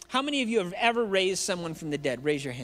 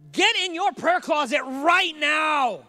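preacher's shout; 7 semitones higher, 5 dB louder, slower than usual. Real, not acted. Preacher scolding YouTube ASMR fans.

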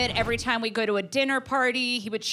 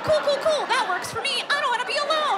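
much higher, laughing, panicky appeasing; desperate pleading. Retold or imitated. She begs her angry boyfriend to stay, hammed-up panic. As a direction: squeak out a frantic plea not to be left.

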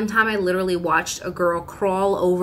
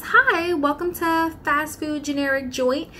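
high, bright drive-thru greeting; chirpy order-taker voice, pitched up; cheery worker voice; 8 semitones higher than usual. Acted. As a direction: sing it like a fast food worker on headset.

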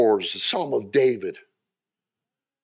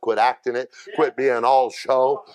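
normal talking voice

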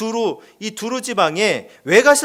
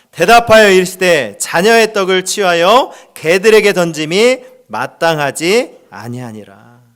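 loud congregational scripture reading; reading aloud together; 2 semitones lower, 8 dB louder than usual. Real, not acted. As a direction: read it out loud with everyone. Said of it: "한 목소리를 크게 읽겠습니다". (회중이 함께 크게 낭독하는 목소리)

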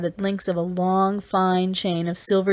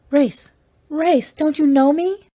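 high, louder cry of recognition; 9 semitones higher, 5 dB louder, slower than usual. Acted. Sister crying out to her long-lost brother, high and loud. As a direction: call out his name in sheer disbelief. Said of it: "Then the woman cried".

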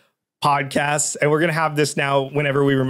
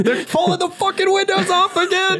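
high, shouted movie line, half sung; mock frantic yell. Podcast host quoting the zombie movie, mock frantic yell. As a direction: yell it like a panicked survivor.

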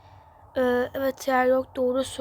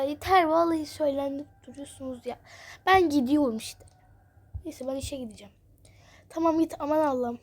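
usual speaking voice

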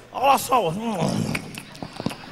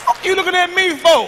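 angry shout; 6 semitones higher, 5 dB louder than usual. Acted. Acted shout at woman staring.

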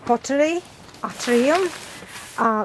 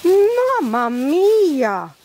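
very slow, loud, drawn-out amazement; amazed exclamation; 3 semitones higher, 5 dB louder, much slower than usual. Real, not acted. Admiring pots needing a special shelf, amazed. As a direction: stretch it out in astonishment.

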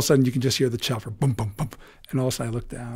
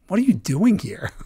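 high, loud, astonished question; can't believe he's here; amazed question; 9 semitones higher, 5 dB louder than usual. Retold or imitated. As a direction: pitch jumps up in delight.

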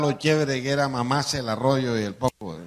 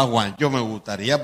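ordinary delivery

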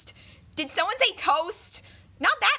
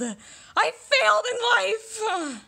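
louder, higher teary wail on failed; crying wail; 7 semitones higher, 3 dB louder than usual. Acted. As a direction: wail it near tears. Laments her failure.

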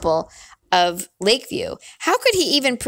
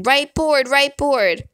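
higher-pitched urgent yell; mildly raised old woman's yell; 5 semitones higher, slower than usual. A narrator's reading. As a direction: call it out high, like a warning. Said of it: "the old woman yelling".

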